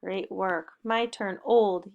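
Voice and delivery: ordinary delivery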